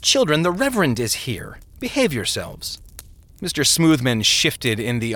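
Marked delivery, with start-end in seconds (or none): 0.0-2.8 mother's mild scolding, pitched up at first; mild chiding. A narrator's reading. Mother scolds her boys before the Reverend, mildly chiding. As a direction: chide the children. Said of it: "chided the boys".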